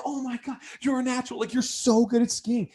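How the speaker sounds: high, excited gushing; hyped buddies. Retold or imitated. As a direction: gush like amazed friends. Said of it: "and they're crazy. They're like".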